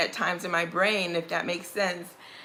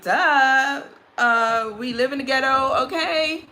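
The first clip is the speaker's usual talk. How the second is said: higher, louder mock-teen comeback; sassy retort; 5 semitones higher, 5 dB louder than usual. Talking back to mother and grandmother, sassy. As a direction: state the obvious, duh.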